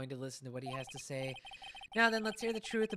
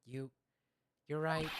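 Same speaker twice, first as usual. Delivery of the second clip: ordinary talk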